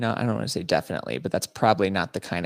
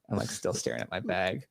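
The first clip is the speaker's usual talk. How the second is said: usual speaking voice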